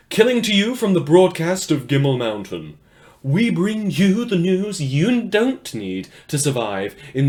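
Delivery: booming, bright radio host opening the show; upbeat on-air news read with a loud welcome; radio announcer. Acted. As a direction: read the news like a cheery wasteland DJ. Hosts a radio news broadcast.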